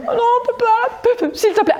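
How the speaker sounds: put-on high, pleading child's whine, half laughing; whiny little girl. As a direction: play a four-year-old begging her dad. Acting little Martine's protest.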